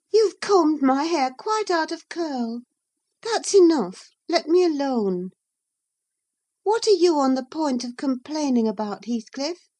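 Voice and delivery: put-on high, loud, impatient girl's voice; fretful Catherine. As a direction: shoo the maid away, then press him.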